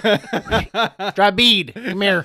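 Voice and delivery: high, loud Southern holler; mock Southern calling-out; Southern accent. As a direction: call it out in a hammed-up Southern accent. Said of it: "go back to the southern accent".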